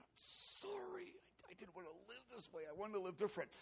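imitating sobbed remorse; weeping apology. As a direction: drag out "sorry" as if weeping, then hurry on. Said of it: "and weeping. I said".

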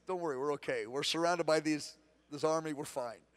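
low, soft, steady reassurance; calm Elisha comforting his servant; calm reassuring prophet. Retold or imitated. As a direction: settle the worried servant. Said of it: "And Elisha said".

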